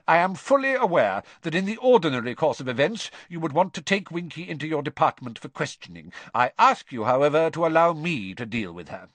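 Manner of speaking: brisk, firm formality; curt. A narrator's reading. Crouch claiming the elf from Diggory, curt. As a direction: open fast and loud, brooking no argument. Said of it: "said Mister Crouch curtly".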